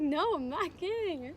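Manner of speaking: high, loud, drawn-out insistence; emphatic retold denial. Woman quoting her fiancé's proposal reply, emphatically insistent. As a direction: stretch out the denial, imitating him.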